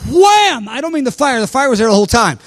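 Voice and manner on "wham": very high, sudden "wham"; exclaimed impact. Retold or imitated. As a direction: act out a second jolt striking. The power hits again.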